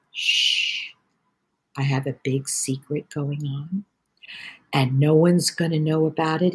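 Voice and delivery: shh into a soft, hushed voice; hushed secret. Retold or imitated. Telling nephew a secret.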